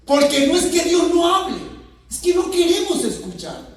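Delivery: preacher scolding the congregation, loud and fervent; full-force preaching. Acted.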